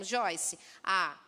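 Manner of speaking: usual speaking voice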